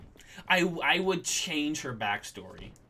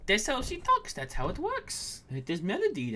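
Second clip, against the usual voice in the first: put-on much higher squeaky girl voice, insistent; Melody voice; 11 semitones higher than usual. DM insisting to players in Melody's higher voice. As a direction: squeak it out, defending the voice. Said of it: "And I'm like".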